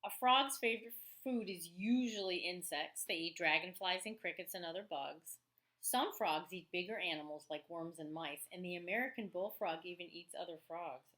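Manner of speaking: teacher reading a nonfiction book to her class, lively; reading aloud to children. Acted. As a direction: read the book to the kids with energy. Reads the frog book aloud.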